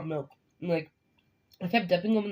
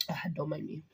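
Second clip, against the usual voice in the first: ordinary talk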